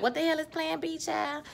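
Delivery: high, soft, puzzled question; baffled aside. Retold or imitated. Viewer reacts to news of a Plan B, baffled. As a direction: ask it small and high, bewildered.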